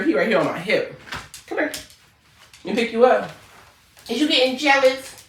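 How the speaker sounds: high, soft cooing to a pet. Real, not acted. Talking to Cash on her hip, soft cooing baby talk.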